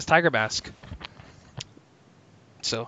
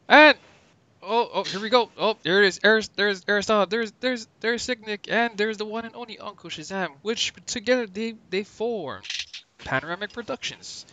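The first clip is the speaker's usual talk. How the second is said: Jim Ross voice; 6 semitones higher, 5 dB louder than usual. Retold or imitated. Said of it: "A K A Jim Ross".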